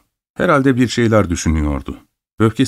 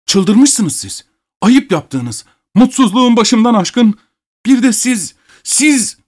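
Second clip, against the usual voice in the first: high-pitched, agitated protest; worked-up outburst, rising to a cry; outraged retort; 13 semitones higher, slower than usual. A narrator's reading. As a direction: let the voice climb with indignation. Abogin's outraged retort in the quarrel.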